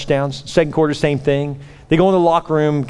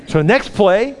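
normal talking voice

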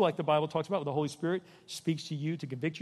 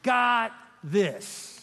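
higher and louder, climbing to a shout; preaching at full force; 9 semitones higher, 5 dB louder, slower than usual. Acted. Pastor voicing God to his people, climbing to a preaching shout. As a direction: build to a ringing cry, word by word.

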